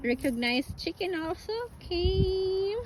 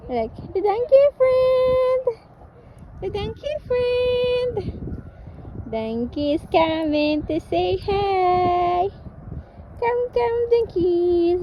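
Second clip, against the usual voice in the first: high, loud, drawn-out singsong call; calling out to animals; 6 semitones higher, 7 dB louder, slower than usual. Real, not acted. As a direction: call the donkeys over from afar, excitedly. Calling the approaching donkeys.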